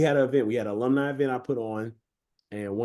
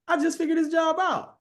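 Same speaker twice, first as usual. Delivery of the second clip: squeaky disbelief; 3 dB louder than usual. Retold or imitated. Coach just fired, squeaking up high in dismay. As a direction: leap way up into a squeaky, strained voice. Fired right after figuring job out.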